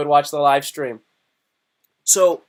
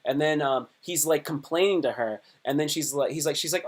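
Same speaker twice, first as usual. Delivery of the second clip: ordinary talk, nothing marked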